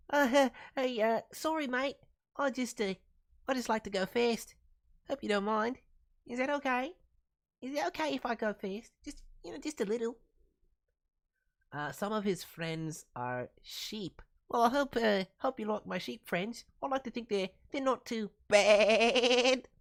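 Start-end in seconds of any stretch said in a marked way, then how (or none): 0.1-10.2 squeaky, high Kiwi-ish bunny voice, sheepishly asking; a shy fast rabbit apologising, pitched up. Acted. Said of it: "Bradford Brody Bunny would say". 14.5-19.7 lightly pitched-up bunny voice; a hint of the Kiwi bunny, hopeful; bunny character voice. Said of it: "he's a high-pitched Kiwi accent".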